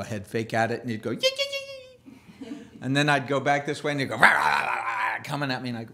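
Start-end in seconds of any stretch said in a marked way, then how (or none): 1.1-2.6 high yelping dog noise, laughing through it; squeaky yee yee yips; dog yelp imitation. 4.2-5.2 loud mock bark; dog bark imitation.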